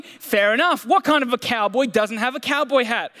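ordinary talk, nothing marked

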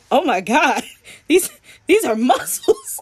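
amazed and giggling, pushed up high; laughing amazement. Retold or imitated. Retelling touching his muscles, laughing in high amazement. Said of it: "I kept going like".

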